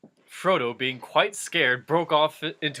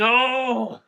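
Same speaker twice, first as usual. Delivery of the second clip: protest; 10 semitones higher, 8 dB louder than usual. Real, not acted. Listener protests the wrong name.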